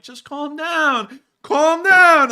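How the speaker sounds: high, drawn-out yell, building to full volume; exasperated shout. Acted. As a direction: yell at an overheated commenter to settle. Tells overheated commenter to calm down.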